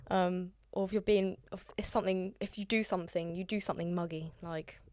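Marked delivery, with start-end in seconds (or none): none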